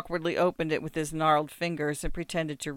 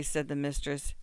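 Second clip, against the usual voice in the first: usual speaking voice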